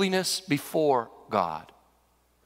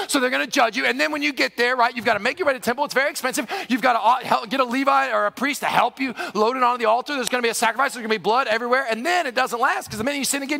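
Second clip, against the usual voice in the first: higher, indignant tirade; outraged scribe; 6 semitones higher, 3 dB louder than usual. Acted. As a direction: rattle off demands, building to a loud challenge. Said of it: "They were outraged".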